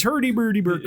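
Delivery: high, quick mock-Scandinavian gibberish; comic sing-song Swedish nonsense; Swedish Chef. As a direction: burble it in a silly Swedish lilt. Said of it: "sounds a little bit like the Swedish Chef".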